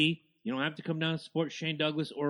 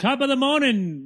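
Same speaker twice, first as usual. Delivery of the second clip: a hammed-up Irish lilt, louder and higher; mock Irish greeting; 6 semitones higher, 6 dB louder than usual. Cohost mocking Irish listeners in a fake Irish accent. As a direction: toss off a jokey Irish hello. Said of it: "be like Sheamus and be like".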